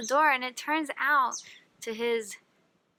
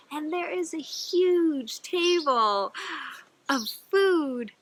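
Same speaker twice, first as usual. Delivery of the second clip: awed; 5 semitones higher, 4 dB louder, slower than usual. A narrator's reading. Awe at huge food table.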